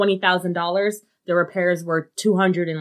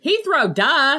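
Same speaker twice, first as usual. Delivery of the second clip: high, louder mock-incredulous interjection; obvious-answer duh; 8 semitones higher, 3 dB louder, slower than usual. Real, not acted. Listener supplying the airport the scammer didn't know, mock incredulous. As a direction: toss it in high, as if anyone would know.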